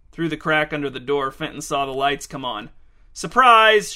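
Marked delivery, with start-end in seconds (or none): 3.1-3.9 loud, high party shout; shouted surprise. A narrator's reading. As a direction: burst out with it to greet someone. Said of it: "shouted one person".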